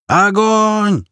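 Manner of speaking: high, sustained battle yell; yelled order. A narrator's reading. Squad leader orders everyone to shoot, yelling. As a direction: bellow the command, holding the word out. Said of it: "заорал чех".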